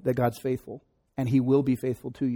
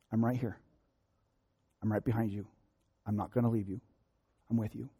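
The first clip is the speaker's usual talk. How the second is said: much softer, tender voice of comfort; tender whispered reassurance; 2 semitones lower, 8 dB quieter than usual. Acted. Pastor voices God comforting the congregation, tender and soft. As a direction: speak God's comfort gently. God's voice quoted in prayer.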